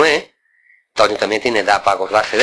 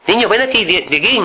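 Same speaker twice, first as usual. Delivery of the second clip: imitating uncle calling him, higher pitch; high mock old uncle voice; 9 semitones higher than usual. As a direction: uncle beckoning the boy, raised voice. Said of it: "me cogió".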